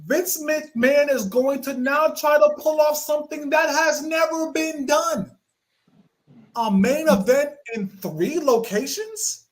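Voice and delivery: punchy dramatic cold-open read; wrestling show teaser with emphatic opening; show intro announcer. Acted. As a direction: announce it big, then pause before the payoff.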